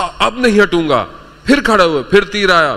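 loud, high burst of preaching; emphatic retelling. Retold or imitated. Preacher retelling a man vowing to stand against arrows, emphatic. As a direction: hurl out the retold resolve.

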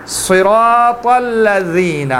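صراط recited slowly with a thick, heavy ص; heavy recitation. Shown as a demonstration. Teacher reciting Quran slowly, heavy ص. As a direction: chant صراط الذین slowly, heavy first letter. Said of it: "اس کو موٹا پڑھیں گے".

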